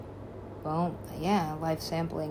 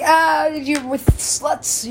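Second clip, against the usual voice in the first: slow, higher mocking retort; drawn-out sneer; 9 semitones higher, 7 dB louder, much slower than usual. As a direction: stretch it out with a sneer. Retold retort to boyfriends.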